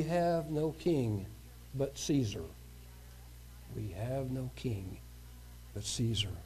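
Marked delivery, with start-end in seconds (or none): 3.8-6.3 hushed echo. Acted. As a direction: let the line die away like a grim echo.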